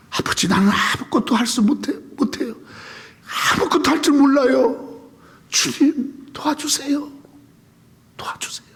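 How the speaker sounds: prayer to Father, fading into a whisper; pleading prayer fading. Retold or imitated. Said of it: "voiced prayer, fading to whisper".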